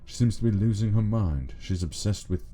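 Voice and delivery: ordinary talk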